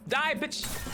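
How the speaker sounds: ordinary talk, nothing marked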